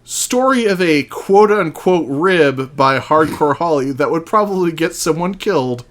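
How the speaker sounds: ordinary delivery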